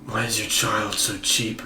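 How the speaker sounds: normal talking voice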